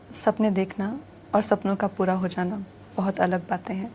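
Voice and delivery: soft, quietly heartbroken reading; a touch of sorrow, held back; mild sadness. Shown as a demonstration. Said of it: "I'll do like a mild sadness".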